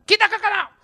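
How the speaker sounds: high loud mock order; mock bossy father. Preacher mocking a father giving orders, loud high mock command. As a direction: bark it like a father who leads from behind. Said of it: "Jangan bilang".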